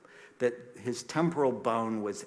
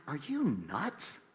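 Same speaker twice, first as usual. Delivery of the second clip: slow, higher-pitched disbelief; retold incredulous comeback; 4 semitones higher, 3 dB quieter than usual. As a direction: stretch out the question, rising in mock astonishment. Retold incredulous reaction repeated.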